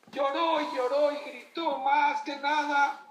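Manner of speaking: narración a gritos, aguda y alargada; clímax gritado. A narrator's reading. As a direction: cuenta el berrinche alzando la voz, arrastrando las palabras. Clímax del cuento, llanto y gritos. (clímax del cuento, lento y gritado)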